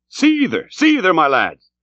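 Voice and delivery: high call that falls away; urging rowers. A narrator's reading. Starbuck drives his lads on, calling out high.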